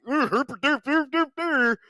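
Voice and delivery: high, loud dopey derp voice; dumb voice. Retold or imitated. Mocking themselves as dumb viewers in a dopey voice. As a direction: play a brainless goof gawking. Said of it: "our dumbasses would have been like".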